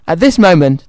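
usual speaking voice

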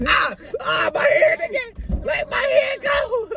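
high, slow pained wailing; crying shout. Acted. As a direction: yell through fake tears. Hair being pulled, yelling.

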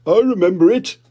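jolly put-on dad voice; Daddy Pig. Daddy Pig remembering, hearty dad voice. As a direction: chuckle it out as a big daddy pig. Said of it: "said Daddy Pig".

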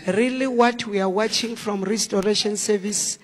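ordinary talk, nothing marked

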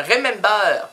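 "remember" loud, with an open "-bar" ending; French-style English. Shown as a demonstration. Mot anglais prononcé pour les Français. (« remember » prononcé fort, à la française)

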